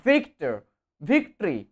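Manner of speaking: "ভিক্টর, ভিক্টরি" each opening on a toothy English v; correct V sound. Shown as a demonstration. Teacher giving further examples, "ভিক্টর, ভিক্টরি" said with a true v. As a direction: punch out "ভিক্টর, ভিক্টরি" as victor, victory, with v. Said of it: "ধরনের প্রোনাউন্সিয়েশনগুলো আসবে".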